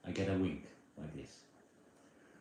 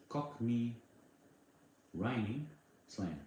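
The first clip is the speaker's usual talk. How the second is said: slow teaching; 2 semitones higher, slower than usual. Shown as a demonstration. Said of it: "I can teach you".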